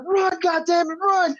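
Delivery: very high, strained mock yelling; mock screaming. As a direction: imitate sergeants screaming urgent orders. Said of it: "they're just screaming".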